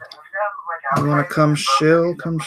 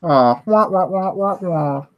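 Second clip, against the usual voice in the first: Peanuts adult trombone voice; muffled wah wah talk; 6 semitones higher, slower than usual. Retold or imitated. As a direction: wah wah like the unseen teacher. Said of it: "You're a good man, Charlie Brown".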